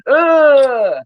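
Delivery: loud, high, drawn-out groan; mock answering moan. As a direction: moan back loudly, hammed up. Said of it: "he just kept moaning".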